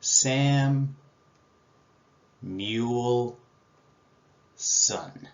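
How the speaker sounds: "Sam", "Mule", "Son" split into separate beats, last part whispered; syllable by syllable. Shown as a demonstration. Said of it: "This is a tough word".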